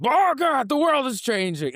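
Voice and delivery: high-pitched, loud mock yell; yelling old woman. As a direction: holler it out as a panicked old lady, hammed up. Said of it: "this old broad is yelling at me".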